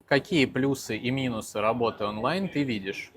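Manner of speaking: ordinary delivery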